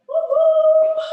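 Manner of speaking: loud, high woo-hoo; celebratory whoop. Real, not acted. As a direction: whoop loudly to cheer the class on. Cheering on a workout class.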